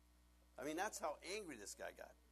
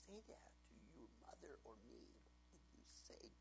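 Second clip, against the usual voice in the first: stern father; 3 semitones higher, 7 dB quieter than usual. Retold or imitated. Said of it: "I'd just like".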